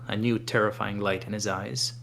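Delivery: plain reading voice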